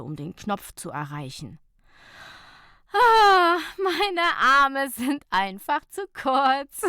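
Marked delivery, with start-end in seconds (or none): 2.9-6.8 high, loud, laughing complaint, slow. Acted. Nicola at the parking machine, laughing. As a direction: laugh at your own short arms. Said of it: "lachte Nicola".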